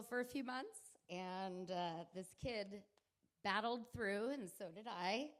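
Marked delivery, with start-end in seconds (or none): none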